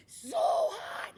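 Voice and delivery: high hammed-up whine of a tourist melting in the heat; mock wilting tourist. Teacher mimics visitors complaining about the humidity, high mock whine. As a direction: squeal it like you can't bear the heat. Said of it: "People come in".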